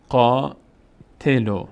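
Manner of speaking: قاتلو with a held long aa; slow recitation. Shown as a demonstration. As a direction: draw out قاتلو. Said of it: "فتحه بعدش الف صدای آ".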